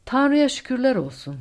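high, loud thanks; relieved exclamation. A narrator's reading. Co-pilot thanking God after the rescue, relieved exclamation. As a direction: exclaim as the danger lifts.

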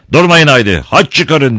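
high, loud order; commanding. Acted. Don Camillo ordering the mob to cross themselves, loud and commanding. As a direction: bark an order at the crowd. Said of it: "diye buyurdu".

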